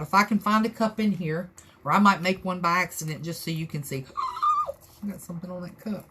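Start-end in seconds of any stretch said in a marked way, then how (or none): 4.1-4.7 sharp high startled gasp; quick surprised intake of breath; sudden gasp. Real, not acted. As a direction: gasp at a spill you just noticed. Spots something on the cup.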